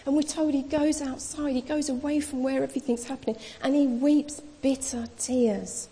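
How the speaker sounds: normal talking voice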